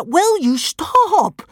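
high, drawn-out, exasperated plea; panting. A narrator's reading. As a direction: beg for a halt, loud and winded. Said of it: "panted Hiccup".

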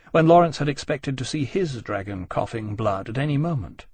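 plain reading voice

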